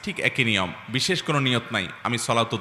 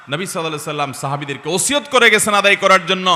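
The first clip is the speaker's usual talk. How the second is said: impassioned preaching; 6 semitones higher, 6 dB louder than usual. Acted. Preacher reminding the crowd of the Prophet's bequest, impassioned preaching. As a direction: voice climbing high and loud.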